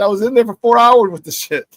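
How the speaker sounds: retold loud disbelief. Retold exasperation, laughing after.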